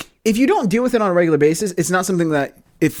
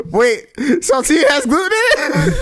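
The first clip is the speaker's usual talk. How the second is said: laughing; 7 semitones higher, slower than usual. Retold or imitated. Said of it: "She didn't realize".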